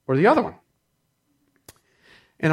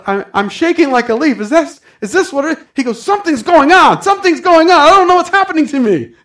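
overwhelmed excitement; 12 semitones higher, 7 dB louder than usual. Retold or imitated. Rabbi overwhelmed receiving Holy Ghost.

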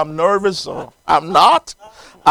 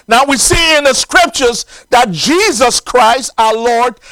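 raised, emphatic preacher's delivery; preaching; 8 semitones higher, 8 dB louder than usual. Acted. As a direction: preach it out with ringing, drawn-out phrase endings. Preaches at full force.

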